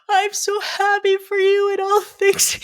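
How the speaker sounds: high, sobbing, slowed words through tears; bawling. Retold or imitated. Telling her partner her feelings, bawling through it. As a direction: choke it out while crying hard. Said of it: "I'm bawling".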